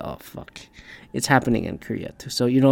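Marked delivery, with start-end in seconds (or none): 0.0-0.6 breathy whisper, no voice; whispered dismay. Retold or imitated. Reading news of Korea, whispering in dismay. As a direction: whisper it under your breath. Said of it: "I just go".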